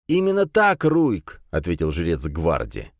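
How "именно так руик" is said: high, insistent priest voice; priest character. A narrator's reading. Priest answers the warrior, high zealous voice. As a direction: proclaim it high, full of zeal.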